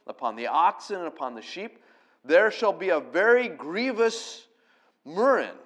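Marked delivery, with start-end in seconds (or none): 2.2-5.5 murrain said slowly with weight on the first syllable, MUR-rain; murrain, stress on MUR; reading murrain. Shown as a demonstration. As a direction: read out murrain, leaning on MUR. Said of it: "the emphasis is on the first syllable".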